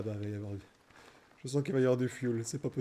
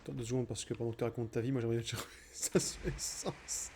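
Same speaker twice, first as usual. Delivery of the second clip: fast aside; 4 semitones lower, 5 dB quieter, faster than usual. Real, not acted.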